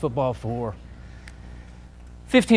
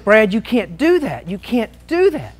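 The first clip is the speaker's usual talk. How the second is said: higher, louder mock protest, a bit slow; imitated coach yell; 5 semitones higher, 4 dB louder, slower than usual. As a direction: play the upset coach half-yelling. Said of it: "He's screaming at me".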